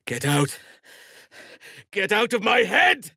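rising to a high, loud scream; building pained yell; anguished shout. Acted. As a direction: tear the intruder from your mind. Mind attacked by parasite.

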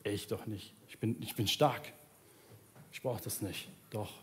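ordinary talk

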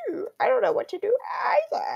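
very high cracking mock yell; straining Freddy voice. Vlogger strains to recreate Freddy voice, very high crack. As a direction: push it way up until it cracks. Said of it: "I can't, I can't do it".

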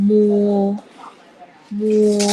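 loud, sustained "มัว" on a level note; demonstrating pinyin sound. Shown as a demonstration. Teacher models the next letter for pupils, loud drawn-out "มัว". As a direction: hold "มัว" long, loud and even. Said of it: "ต่อไปค่ะลูก".

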